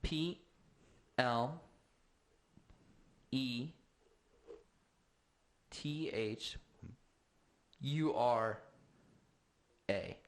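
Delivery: slow letter-by-letter spelling. Real, not acted. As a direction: spell each letter with pauses. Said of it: "Uh, plethora".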